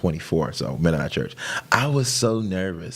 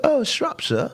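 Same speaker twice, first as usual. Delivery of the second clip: put-on British accent; slower than usual. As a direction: mimic his wife's British accent on Shropshire. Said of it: "I changed my accent".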